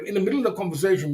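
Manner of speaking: ordinary talk, nothing marked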